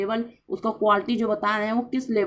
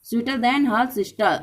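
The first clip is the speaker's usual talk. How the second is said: slow example read; much slower than usual. Shown as a demonstration. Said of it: "लेकिन हम देखिए एक शब्द जोड़ के हम बनाएंगे".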